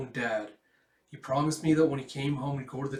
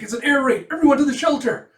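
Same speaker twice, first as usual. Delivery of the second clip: shouting; 13 semitones higher, 15 dB louder than usual. Acted. Air raid alarm.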